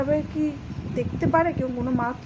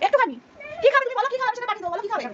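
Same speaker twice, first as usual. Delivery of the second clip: pleading; 10 semitones higher, much faster than usual. Real, not acted. Pleading with crying child.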